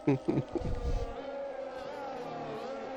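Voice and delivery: squeaky high-pitched laugh. Real, not acted. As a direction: burst out in a high giggle. Laughs at Carl's complaint.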